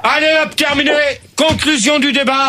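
high-pitched shouted announcement; exasperated, pitched high and shouted; angry cut-off. Real, not acted. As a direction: end the debate in a temper. Host angrily ends the debate.

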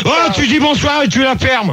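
high, irritated telling-off; real exasperated command to shut up; angry order. Real, not acted. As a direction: cut the caller off sharply. Host cuts off rambling caller.

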